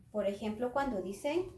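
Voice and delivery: ordinary delivery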